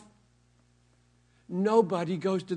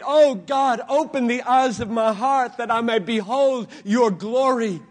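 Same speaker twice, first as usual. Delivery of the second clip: loud, intense pleading prayer; fervent prayer; 4 semitones higher, 9 dB louder than usual. Acted. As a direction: pour it out to God. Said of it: "Pray like he prayed".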